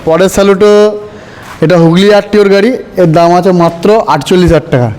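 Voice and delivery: ordinary delivery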